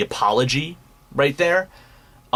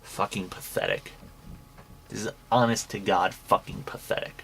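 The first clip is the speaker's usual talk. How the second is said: quiet disgust; 3 semitones lower, 8 dB quieter than usual. Real, not acted. Disgusted verdict on the apology.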